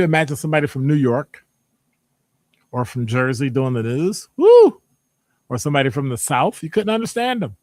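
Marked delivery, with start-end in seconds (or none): 4.4-4.8 high, loud whoop of delight; excited whoop. Real, not acted. Host whoops at imagining a Jersey newscaster, loud. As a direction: let out a loud woo.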